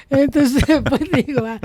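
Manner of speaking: normal talking voice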